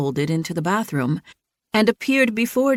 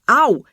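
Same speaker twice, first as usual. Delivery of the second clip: pained ow; 6 semitones higher, 7 dB louder than usual. A narrator's reading. Dylan hit hard.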